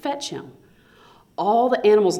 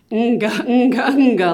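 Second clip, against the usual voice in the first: loud booming laugh sounds, a bit higher; lion's laugh; 5 semitones higher, 7 dB louder than usual. Acted. King Lion laughing at the iguana, loud laugh. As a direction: let the lion laugh out big. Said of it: "laughed the lion".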